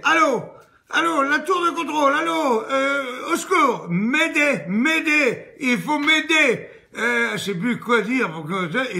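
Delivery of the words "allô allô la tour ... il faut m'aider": high, loud frantic radio call; panicked cry for help. Acted. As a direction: scream into the mic in total panic. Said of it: "complètement paniquée".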